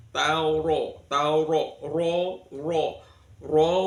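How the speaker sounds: tauro with a thick, full ro; heavy r. Shown as a demonstration. Teacher demonstrating a thick ro to students. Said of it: "dibaca tebal".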